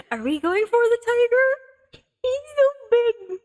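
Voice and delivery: high delighted squeal; giddy squeal. Real, not acted. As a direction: swoon over the big tiger. Gushing about the tiger.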